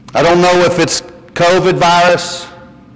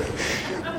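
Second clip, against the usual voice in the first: breathy laugh; 17 dB quieter than usual. Real, not acted. Laughing at own story.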